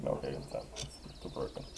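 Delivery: breathy muttered whisper; hushed grumble at the glitch; whispered aside. Real, not acted. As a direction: whisper it under your breath.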